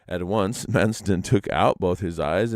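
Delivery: ordinary delivery, nothing marked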